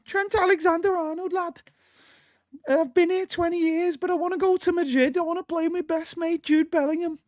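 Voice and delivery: hammed-up Scouse impression, Liverpool accent all through. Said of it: "that was my Scouse accent of Trent".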